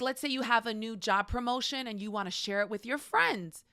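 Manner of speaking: normal talking voice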